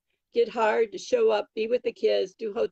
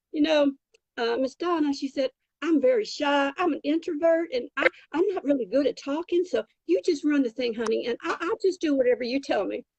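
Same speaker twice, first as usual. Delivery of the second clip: lightly imitated Texas accent, pitched higher; sweet Texas accent; 4 semitones higher than usual. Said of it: "her little sweet Texas accent".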